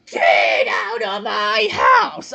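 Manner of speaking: very slow, loud drawn-out yell; long, strained mock bellow; Squidward impression. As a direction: attempt a cartoon grump's stretched-out shout. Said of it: "I can't even do his impression from back then now".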